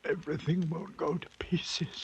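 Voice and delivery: fearful whisper; breathless hushed fear. Acted. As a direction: hold yourself together.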